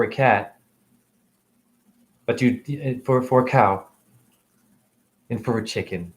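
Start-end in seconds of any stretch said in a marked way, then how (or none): none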